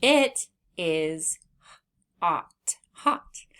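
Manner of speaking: hot. whispered, then hot. again; slow sounding out of hot. Shown as a demonstration. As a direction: breathe out the h in hot. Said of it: "let's read our sentence".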